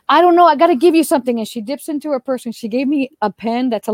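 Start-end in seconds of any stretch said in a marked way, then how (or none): 0.1-1.4 high, loud gushing insistence; imitating an overwhelmed admirer; flustered fan. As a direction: burst out, desperate to give a gift.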